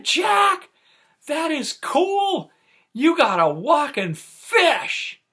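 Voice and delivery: high, loud and slowed thrill; excited shout. Retold or imitated. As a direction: shout with delight, stretching that is cool. Thrilled by walking fish.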